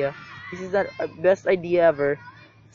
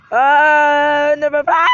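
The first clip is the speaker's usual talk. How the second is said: loud drawn-out scream; raging gamer; 8 semitones higher, 9 dB louder, much slower than usual. Real, not acted. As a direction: scream at the game. Scream in game.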